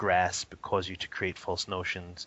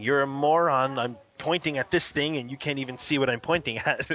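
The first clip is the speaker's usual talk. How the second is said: loud, raised voicing of a frustrated baby; mock toddler scream; 5 semitones higher, 4 dB louder than usual. Father voicing his baby son's frustration, loud mock yell. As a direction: yell it like a furious one-year-old. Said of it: "he's more or less screaming".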